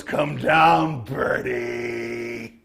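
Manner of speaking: drawn-out taunting call up to prey; taunting enemy boss. Acted. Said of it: "he literally looks up and he goes".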